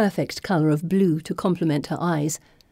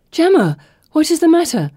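much higher, louder worried cry; alarmed friend; 10 semitones higher, 7 dB louder than usual. Acted. Friend cries out to the fainting girl, high alarmed worried voice. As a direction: shake her out of the faint with worry.

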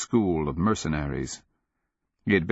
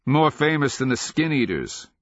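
higher, cheery quip; cheerful; 7 semitones higher than usual. A narrator's reading. Galen describing the stone hags to companions, cheerful. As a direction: toss it out cheerfully, pitched up. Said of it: "Galen said cheerfully".

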